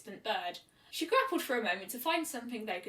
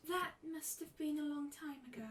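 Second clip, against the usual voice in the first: soft shy voice; 5 semitones higher, 9 dB quieter than usual. A narrator's reading. Fluttershy's soft voice.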